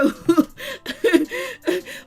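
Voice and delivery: high-pitched whooping laugh; delighted laugh. Real, not acted. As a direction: burst out laughing, gleeful. Delighted at Jennifer coming through.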